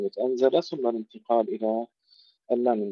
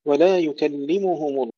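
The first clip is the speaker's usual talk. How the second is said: slow, drawn-out chanted recitation; Quran recitation; 5 semitones higher, 5 dB louder, slower than usual. Acted. Teacher recites the verse to students, slow tajweed chant.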